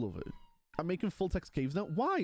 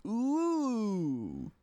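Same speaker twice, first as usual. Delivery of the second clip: long swooping high "ooh"; playful "ooh"; 4 semitones higher, 3 dB louder than usual. Pretend. Streamer teasing Dawncraft to chat, exaggerated playful ooh. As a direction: let the ooh soar up and slide down. Hyping up the game title.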